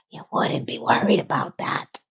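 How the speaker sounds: whispered devilish tone. Acted. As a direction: hiss it like a little devil. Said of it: "I just sound like the devil".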